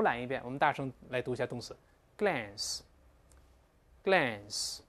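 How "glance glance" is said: slow, drawn-out American "glance" with a flat front vowel; American English. Shown as a demonstration. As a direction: model "glance" the American way, slowly. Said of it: "李老师还用的美式发音". (美式发音慢读glance)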